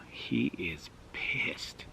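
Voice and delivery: slow, hushed whisper; confiding whisper. Retold or imitated. Neighbor warning the narrator about the dad, slow whisper.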